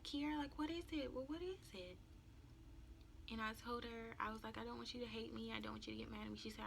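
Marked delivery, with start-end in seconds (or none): 0.0-2.0 soft, higher-pitched mother voice; gentle coaxing mother. Retold or imitated. Mother gently coaxes crying daughter. 4.6-6.4 soft retold plea; small pleading voice. Tearful plea to mother, retold.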